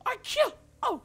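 very high, stammering whine; wailing disbelief. Real, not acted. Gamer who thought the enemy was dead, wailing disbelief. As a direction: wail it, aghast at the injustice. Wailing after losing in game.

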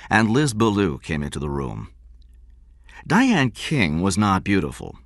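usual speaking voice

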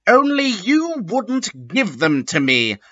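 high, indignant shout; shouted angrily. A narrator's reading. Lupin shouting back at Ebony. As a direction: fling it back, pitched high. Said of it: "Lumpkin shouted angrily".

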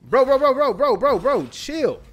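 high, loud frantic yelling of bro; panicked yelling. Real, not acted. Gamer yelling bro at zombies in panic.

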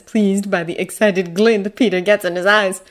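ordinary delivery, nothing marked

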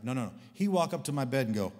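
ordinary talk

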